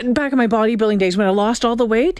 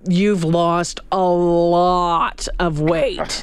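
very slow and low, every word stretched; drawn-out insistence; 7 semitones lower, much slower than usual. Retold or imitated. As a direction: insist by dragging out each word. Retelling man's insistent repeated remark.

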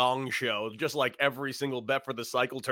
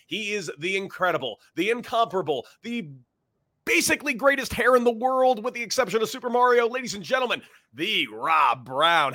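building hype intro, higher and louder; announcer voice; 7 semitones higher, 4 dB louder than usual. Acted. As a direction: introduce him like a boxing ring announcer. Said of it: "Why do you sound so formal".

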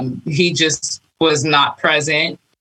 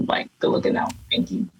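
whispered thanks; 7 dB quieter, faster than usual. Retold or imitated. Private thanks to the Lord.

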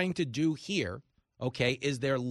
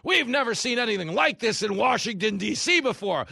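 high, loud whiny mock quote; mocking Democrats; 6 semitones higher, 7 dB louder than usual. Host mocking Democrats in a higher, louder voice. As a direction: sneer it out like a pious politician. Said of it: "Remember when the Democrats said".

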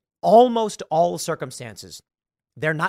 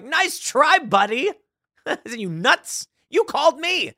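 mocking retort; 10 semitones higher, 4 dB louder, slower than usual. Mocking the scam caller.

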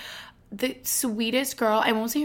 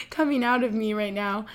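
ordinary talk

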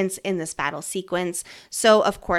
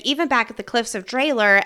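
ordinary talk

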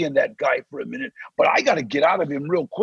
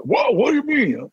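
mock startled; 7 semitones higher than usual. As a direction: play the other guy caught off guard. The other actor taken aback.

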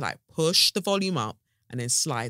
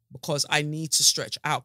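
ordinary delivery

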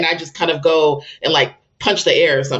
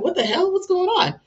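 much higher, bewildered panic; the shock of seeing it happen; shocked disbelief; 14 semitones higher than usual. Retold or imitated. Child vomits up a crystal.

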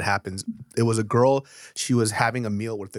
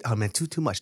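ordinary talk, nothing marked

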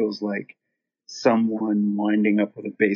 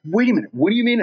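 confused disbelief; 11 semitones higher, 3 dB louder, much faster than usual. Retold or imitated. Drummer voiced, high.

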